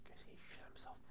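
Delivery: unvoiced aside; unvoiced whisper. Real, not acted. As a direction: whisper it as a joke. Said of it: "whispered aside".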